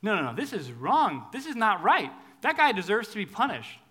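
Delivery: king furious at the rich man; outraged David. Retold or imitated. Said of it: "he's outraged".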